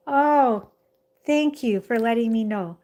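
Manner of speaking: ordinary delivery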